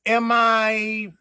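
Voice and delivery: playful offended retort; sassy. Retold or imitated. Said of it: "And I'm like".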